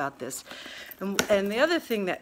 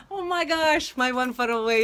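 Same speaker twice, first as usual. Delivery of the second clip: higher, louder thrilled outburst; thrilled at the box; excited gasp; 5 semitones higher, 5 dB louder than usual. Real, not acted. Sees her box arrived.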